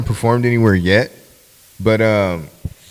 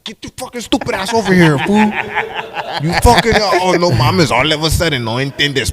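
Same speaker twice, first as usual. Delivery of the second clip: higher laughing mock scold in Spanglish; Theo scolding; 5 semitones higher than usual. Nephew Theo telling him to shut up, laughing scold. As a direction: chew him out while cracking up. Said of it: "I fuck with him like".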